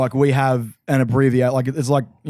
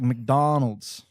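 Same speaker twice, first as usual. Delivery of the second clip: drawn-out, softer American "McDonald's"; imitated American accent; 4 dB quieter than usual. Telling co-host how Americans name everything, in a slow American accent. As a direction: stretch it out like an American.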